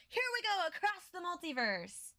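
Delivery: high, excited put-on angel voice; cheery angel. Angels flying out across the multiverse, high cheery put-on voice. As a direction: launch off gleefully like a cartoon angel.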